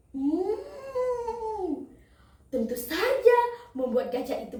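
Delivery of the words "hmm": high, soft, drawn-out "hmmm"; craving hum. Acted. Crocodile eyes the fat baby elephant, long craving hum.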